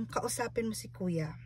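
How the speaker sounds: soft hushed whisper; quietly, like a gentle whisper. Retold or imitated. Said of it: "nag-whisper siya sa akin".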